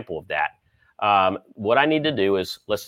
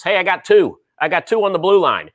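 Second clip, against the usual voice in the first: ticket scalper; 6 semitones higher, 4 dB louder than usual. Pretend. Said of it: "I like to be the guy out there scalping tickets".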